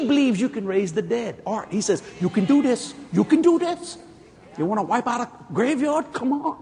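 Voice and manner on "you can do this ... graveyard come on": rising pep talk. Retold or imitated. God cheering Jesse on, building excitement. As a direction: hype him up like a coach.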